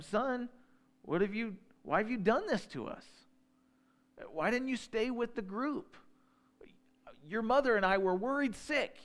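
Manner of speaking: put-on mother scolding her runaway twelve-year-old son; worried mother. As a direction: scold him as his anxious mother.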